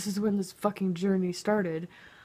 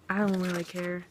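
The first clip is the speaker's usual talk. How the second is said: normal talking voice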